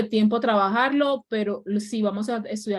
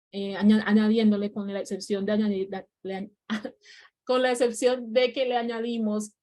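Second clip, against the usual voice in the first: normal talking voice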